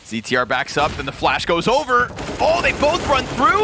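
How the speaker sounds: caster building to an astonished, high-pitched peak; live play-by-play. Real, not acted. Caster calls a push through the flash, building play-by-play. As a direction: build from setup to excited disbelief.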